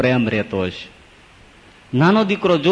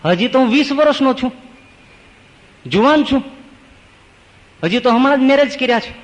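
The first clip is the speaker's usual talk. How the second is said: put-on young man pleading; 9 semitones higher than usual. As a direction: plead that you're only twenty. Young man refuses death.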